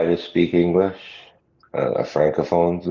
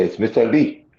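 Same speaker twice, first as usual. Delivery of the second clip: It's Mister Lee said a little higher, mocking; lightly mocking accent; 5 semitones higher than usual. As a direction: imitate him insisting on Lee. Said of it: "then he would say".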